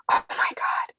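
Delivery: a whispered gasp of disbelief; stunned whisper. Real, not acted. To the host about only two sales, a stunned whisper. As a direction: let it slip out under your breath.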